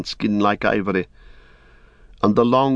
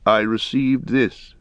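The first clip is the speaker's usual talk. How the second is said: put-on slow, weighty old lord; Denethor, slow and grave; 2 semitones higher than usual. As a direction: let each word fall heavily. Denethor shows the horn.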